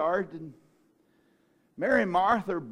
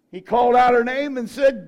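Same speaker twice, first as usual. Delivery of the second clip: normal talking voice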